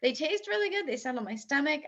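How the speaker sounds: ordinary delivery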